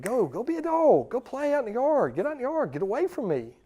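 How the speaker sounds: high, swooping mock exasperation; exasperated shooing. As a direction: send the clingy dog off. Acted owner sending clingy dog outside.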